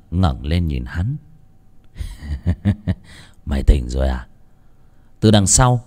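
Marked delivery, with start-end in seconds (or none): 2.0-2.9 low mocking chuckle. A narrator's reading. Gangster laughs at captive. 3.5-4.3 low, menacing sneer; low gangster taunt. A narrator's reading.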